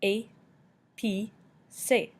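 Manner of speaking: "A, P, C" as three separate sounds, ay, pee, say; sounded out by syllable. Shown as a demonstration. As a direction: pause between each of "A, P, C". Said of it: "making the A sound".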